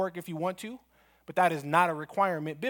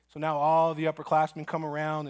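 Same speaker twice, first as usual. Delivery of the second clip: normal talking voice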